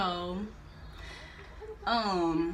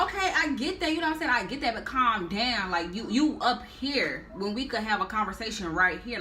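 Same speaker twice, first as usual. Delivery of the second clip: usual speaking voice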